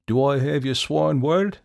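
loud, teasing, smug taunt; mocking. A narrator's reading. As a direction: gloat at the trapped devil. Jack demanding oath.

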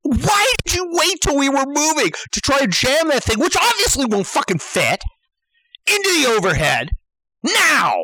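high, incredulous questioning that ends on a punch; worked-up comic interrogation; exasperated demand. Acted. Acted demand at fumbling passenger.

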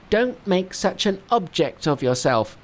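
high monkey voice, mock shout; Monkey snaps at the weeping master. Monkey scolds weeping Tripitaka, high mock shout. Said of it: "shouted Monkey".

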